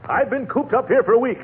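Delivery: high, indignant protest; stubborn refusal. Acted. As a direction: refuse flat out, bristling. Gildersleeve refuses, quarrel.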